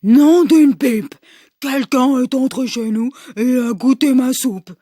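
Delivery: big roaring bear voice. Acted. Papa Bear finding his soup tasted, big roaring voice. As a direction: roar it as a big angry bear. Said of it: "Il rugit de sa grosse voix".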